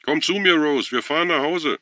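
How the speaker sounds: high, loud shout up a stairwell. A narrator's reading. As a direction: yell urgently to someone upstairs.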